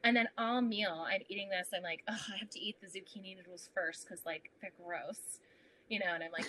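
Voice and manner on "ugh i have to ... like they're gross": softer whispered grumble. Retold or imitated. Whispered grumble to herself over zucchini noodles at dinner. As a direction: grumble under your breath about gross food.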